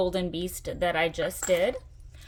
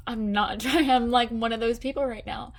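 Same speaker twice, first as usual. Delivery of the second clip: laughing through tears; 4 semitones higher, 4 dB louder than usual. Real, not acted. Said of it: "I'm getting so emotional right now".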